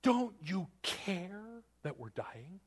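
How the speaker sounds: high, slow pleading cry; desperate plea. Retold or imitated. Disciples plead with the sleeping Jesus, high and slow. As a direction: beg him like you're sinking. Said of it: "They say".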